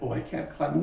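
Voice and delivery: usual speaking voice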